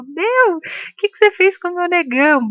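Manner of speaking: high-pitched, laughing mock outrage; joking tease. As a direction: tease her friend playfully, pitch jumped up. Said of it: "ela começou a rir e falou". (brincando, voz bem aguda e risonha)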